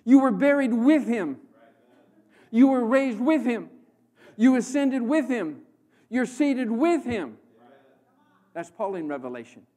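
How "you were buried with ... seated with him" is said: loud, rising preacher's declarations; full-force preaching. Real, not acted. Preacher declaring to the congregation, loud and hammering. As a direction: hammer each line louder at the crowd.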